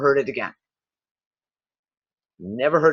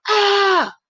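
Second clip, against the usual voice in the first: high, sustained "aah" scream; hammed-up high yell of frustration; mock frustrated scream; 4 dB louder than usual. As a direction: imitate an exasperated customer screaming. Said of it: "scream at the software company going".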